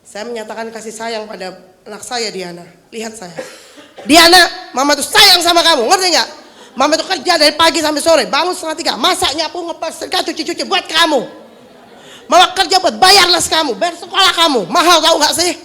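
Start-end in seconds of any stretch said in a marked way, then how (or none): 4.1-15.6 put-on loud, high nagging at her child; a mother who claims love while she scolds; scolding mother. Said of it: "Mama Diana pura-pura jadi anak saya". (ibu yang mengomel keras dan tinggi sambil bilang sayang)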